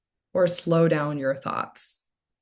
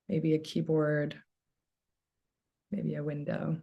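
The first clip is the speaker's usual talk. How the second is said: soft, unhurried meditation voice with long pauses; hushed, calming guidance; guided meditation; 4 dB quieter than usual. Real, not acted. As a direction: gently settle listeners into stillness, leaving space between phrases. Leads a meditation.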